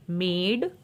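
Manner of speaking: normal talking voice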